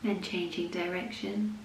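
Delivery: soft, unhurried yoga nidra voice with long pauses; guided meditation. Acted. Leads a yoga nidra practice.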